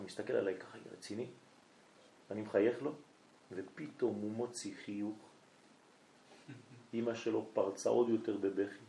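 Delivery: ordinary delivery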